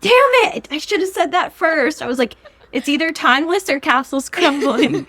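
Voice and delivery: high, loud exasperated rant; loud frustrated. Real, not acted. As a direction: kick yourself out loud.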